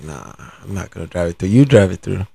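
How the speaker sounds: mock the nervous friend backing out quietly; scared refusal. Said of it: "Then she gets scared".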